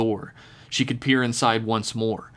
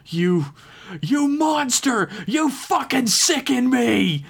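high, slow, hollow scream of disgust; furious scream; 13 semitones higher, slower than usual. Acted. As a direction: scream it at the monster, slowly. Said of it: "T K screamed out in a voice".

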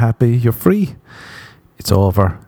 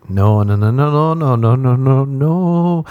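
mock-panicked string of denials, climbing higher; frantic backpedalling; 4 semitones higher than usual. Prankster hurriedly reassuring his friend, in mock panic. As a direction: start near normal and rise to a high, drawn last no.

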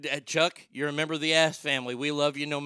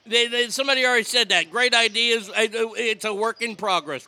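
exasperated loud; 6 semitones higher, 8 dB louder than usual. Real, not acted. Host cuts off repeat caller idea.

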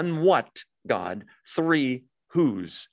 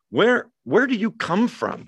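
higher, searching question; Pilate asking; 7 semitones higher, 3 dB louder than usual. Retold or imitated. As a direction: voice Pilate's pressing "Where, where do you come from?". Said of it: "what an interesting question".

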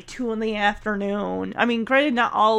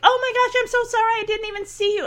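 much higher-pitched mock apologetic lady; imitated frantic apology; 10 semitones higher, faster than usual. Lady in a van apologizes after a crash, high mock-frantic voice.

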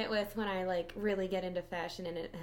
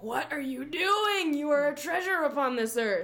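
excited; 5 semitones higher, 4 dB louder, slower than usual. Retold or imitated. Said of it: "kind of thing with fashion".

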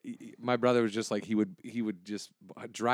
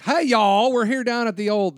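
loud, much higher folksy Texas twang; Texas newscaster parody; 12 semitones higher, 10 dB louder, slower than usual. Retold or imitated. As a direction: play a hokey Texan news anchor. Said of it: "they're not like".

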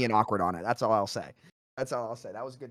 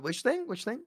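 quiet, high questioning lilt; mock-puzzled little question; feigned ignorance; 8 semitones higher, 10 dB quieter than usual. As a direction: play innocently clueless, softly and high. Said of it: "I just wanna gaslight them".

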